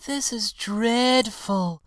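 high, loud, drawn-out lament; dramatic wail of complaint, slow and high; slow, high-pitched distress; complaining pansy. A narrator's reading. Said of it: "grumbled the pansy".

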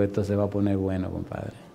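low, soft aside, fading at the end; conspiratorial aside. Retold or imitated. Preacher confiding his thought mid-story, conspiratorial aside. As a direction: drop it under your breath, as to an accomplice. Private aside while retelling argument.

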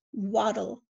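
ordinary talk, nothing marked

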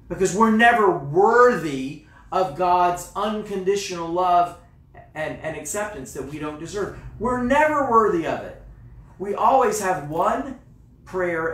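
loud, high preaching; full-force preaching. Acted. Pastor pressing the congregation on grace, loud preaching. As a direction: drive it home from the pulpit.

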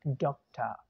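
"डॉक्टर" as DOK-tuh, R dropped; schwa ending. Shown as a demonstration. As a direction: teach "डॉक्टर" with a falling, open uh ending. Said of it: "क्या बन जाएगा".

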